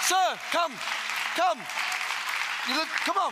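rally the congregation up to the altar; preaching at full force. Real, not acted. Pastor urging people forward, shouting come on.

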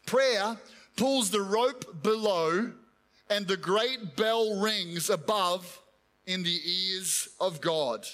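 slow, weighty reading of a quote; solemn quotation. Acted. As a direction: let each phrase ring out slowly. Quoting Spurgeon slowly.

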